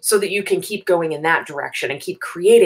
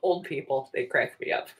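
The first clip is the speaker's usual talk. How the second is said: laughing; 5 semitones lower, 10 dB quieter than usual. Real, not acted. Laughing fade after story.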